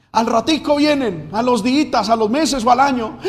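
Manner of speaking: loud, high, fervent preaching; prédica enardecida. Real, not acted. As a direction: raise the voice and drive each phrase. Preacher building on the backsliders' return. (prédica fuerte y aguda)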